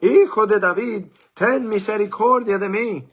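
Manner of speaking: loud high desperate cry; shouting out. A narrator's reading. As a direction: call out across the crowd, pleading. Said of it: "pero él mucho más gritaba". (gritando fuerte y suplicante)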